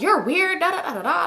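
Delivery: high-pitched mimicking with babble; dismissive, singsong retelling of an argument; mock trash talk. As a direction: imitate a friend mouthing off, tailing into da-da-da. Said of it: "she talking shit to him".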